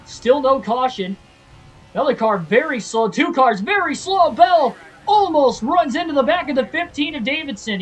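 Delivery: high, loud play-by-play of a wreck; excited race commentary. Acted. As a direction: shout the crash call like a TV announcer. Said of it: "I sound like the announcer".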